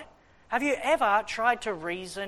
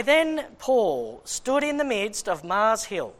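slow, louder formal Bible reading; measured, declaiming King James reading; scripture reading; 4 dB louder, slower than usual. Acted. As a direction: read it out like holy writ. Reads scripture aloud.

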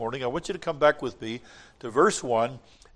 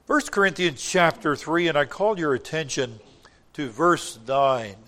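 ordinary delivery

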